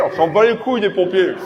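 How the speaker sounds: ordinary talk, nothing marked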